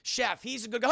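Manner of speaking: ordinary delivery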